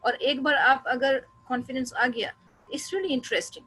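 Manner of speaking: ordinary talk, nothing marked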